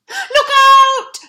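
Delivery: high loud warning cry; shouted. Acted. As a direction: yell it to the others. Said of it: "they shouted".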